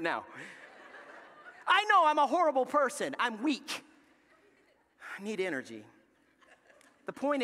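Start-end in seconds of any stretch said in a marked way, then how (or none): 1.7-5.8 hammed-up admission of guilt; mock confession. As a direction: blurt it out to the crowd.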